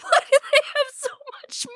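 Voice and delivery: crying with laughter. Real, not acted. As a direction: laugh through the tears. Laughing to tears at the gift.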